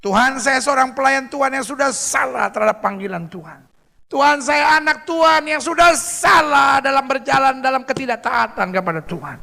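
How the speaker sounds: high, building cry to God; impassioned confession. Acted. Servant confessing failure to God, impassioned prayer. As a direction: confess with rising force.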